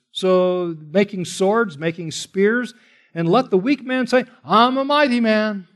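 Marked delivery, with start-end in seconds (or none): 3.1-5.7 mock boast, higher; sarcastic quote of the weak man. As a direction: scoff at the weak man's bragging. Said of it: "this is like sarcasm".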